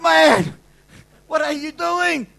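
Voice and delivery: loud, high, drawn-out disbelief; exasperated shout. Retold or imitated. Father asking himself what he's doing, in loud disbelief. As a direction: shout the question at yourself. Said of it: "And I thought".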